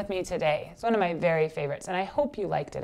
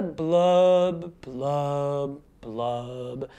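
drawn-out blubs, each lower; fish's blub; 4 semitones lower, much slower than usual. Shown as a demonstration. Teacher shows children the easy part, descending fish blubs. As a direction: blub slowly down in steps like a fish.

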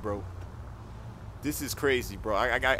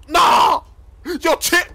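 much higher, much louder burst of disbelief; shocked shout; 13 semitones higher, 10 dB louder, much slower than usual. Acted. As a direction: explode in disbelief. Shocked at the news.

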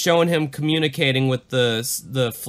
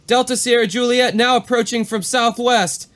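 higher, tense radio call; pilot; 8 semitones higher, 3 dB louder than usual. Retold or imitated. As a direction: call it in under strain. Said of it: "Back to the exchange".

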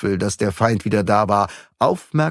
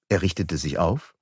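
ordinary delivery, nothing marked

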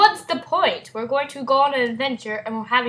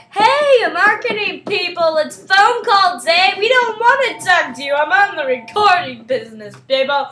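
higher, louder mock telling-off; mock phone rant; 6 semitones higher, 5 dB louder than usual. Vlogger mock-scolds marketing callers, higher and louder. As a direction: shoo the telemarketers off, hammed up. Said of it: "it's marketing".

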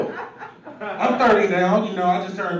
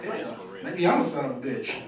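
quiet laughing aside; 5 semitones lower, 8 dB quieter than usual. Retold or imitated. Self-doubting quiet aside.

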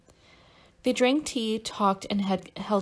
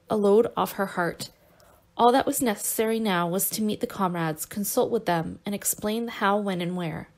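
usual speaking voice

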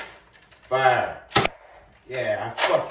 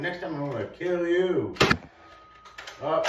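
normal talking voice

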